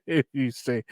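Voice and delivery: ordinary talk, nothing marked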